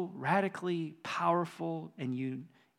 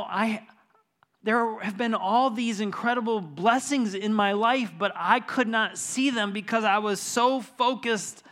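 rising excitement; 6 semitones higher, 8 dB louder than usual. Retold or imitated. Pastor retelling Tom's joy, preaching.

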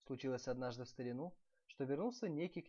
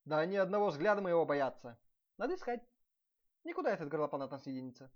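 loud boasting; 2 semitones higher, 9 dB louder, faster than usual. A narrator's reading. Prince boasting to his wife, loud bluster. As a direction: bluster that they all fear you.

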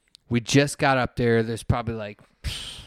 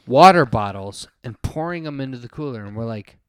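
normal talking voice

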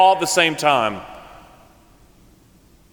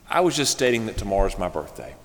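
lower, softer wounded explanation; hurt; 7 semitones lower, 7 dB quieter than usual. Retold or imitated. Birthday woman explaining to her friends, quietly hurt. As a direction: say it small and hurt.

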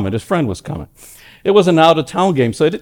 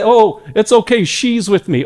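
high, gentle reassurance; Jesus vouching for a sinner, higher and gentle; reassuring Jesus voice; 8 semitones higher, 3 dB louder than usual. Acted. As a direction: reassure the Father, tenderly. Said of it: "he'll say".

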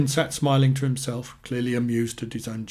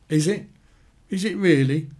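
higher, louder retort; angry challenge; 5 semitones higher, 5 dB louder than usual. A narrator's reading. Annie to Amanda, doubting her denial, angrily challenging. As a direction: throw the denial back, pitched up.

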